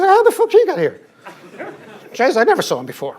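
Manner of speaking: loud, very high incredulous outburst; mock disbelief. Colleagues on the silent man who reached the top, mock disbelief. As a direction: squeal the question in comic outrage.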